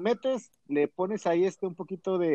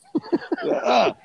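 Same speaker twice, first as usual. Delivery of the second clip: laugh; 13 semitones higher than usual. Real, not acted. Laughs after his story.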